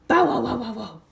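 loud big-dog bark; loud bow-wow dog. Acted. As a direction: bark it out like a hound.

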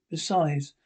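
usual speaking voice

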